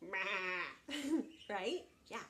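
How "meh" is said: imitated goat bleat. Shown as a demonstration. Storyteller showing a child what a goat says, bleating. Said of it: "It goes".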